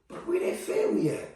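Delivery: softly imitated bewilderment; hushed mock puzzlement; puzzled aside. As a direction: wonder about someone under your voice.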